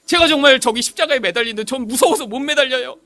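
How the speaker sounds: high, loud child's plea retold; frightened pleading. Scared child prays to be spared the cross, frightened pleading. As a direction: beg in mock tearful panic. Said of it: "무서워서 막 울다가 제가 기도했어요".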